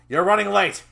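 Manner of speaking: loud, high angry outburst; scolding shout. Acted. Trainer scolding his late trainee, angry shout. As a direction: shout it at a latecomer.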